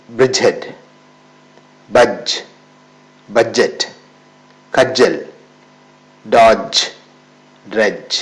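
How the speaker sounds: each word with a silent d, spoken clearly and firmly; teacher modelling words one by one with the d silent; model pronunciation. Shown as a demonstration. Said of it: "ഒന്നുകൂടി പറയാം". (ഡി silent ആക്കി വ്യക്തമായി ഉച്ചരിക്കുന്നു)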